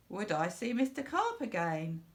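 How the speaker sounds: ordinary delivery, nothing marked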